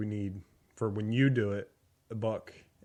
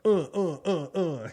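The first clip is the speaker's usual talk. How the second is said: strained workout grunts, higher; mock grunts; 8 semitones higher, 5 dB louder than usual. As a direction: grunt it out loud. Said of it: "when they edit and they go".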